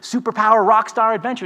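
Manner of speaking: high, bright mock-corporate announcement; cheery email voice. Mocking the company's cheery firing emails, high and upbeat. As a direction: read it like a peppy HR memo. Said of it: "we get these cheery emails saying".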